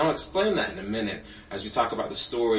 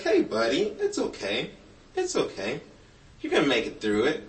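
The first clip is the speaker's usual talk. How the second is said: comforting a crying buddy; 3 semitones higher than usual. Acted. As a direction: soothe a weeping little one, starting high. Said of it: "if you saw him crying".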